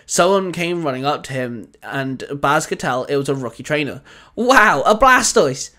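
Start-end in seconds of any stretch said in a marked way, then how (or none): 4.3-5.7 higher, louder and slow amazed shout; eager kid calling out in wonder; excited call. A narrator's reading. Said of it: "the trainer called out".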